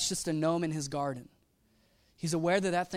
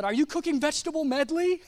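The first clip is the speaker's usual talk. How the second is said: mock eager question; 8 semitones higher than usual.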